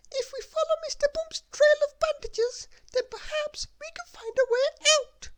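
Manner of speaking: very high squeaky little voice; Little Miss Tiny. Acted. Little Miss Tiny finding a bandage, very high squeak. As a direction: squeak it like someone very small.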